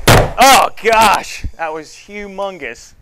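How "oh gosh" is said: loud, high startled cry; shocked exclamation. Real, not acted. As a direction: blurt it out, stunned by the blast. Reacting to the balloon fireball.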